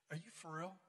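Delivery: soft, low, earnest question; hushed disbelief. Retold or imitated. Front row girl asking the singer, hushed disbelief. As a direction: ask it quietly, almost reverently. Retold awed classmate asks girl.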